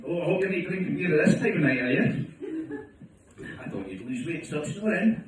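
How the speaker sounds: hammed-up nagging wife at night; nagging wife, mocked. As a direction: rattle it off like a scolding wife. Wife scolding from the stairs.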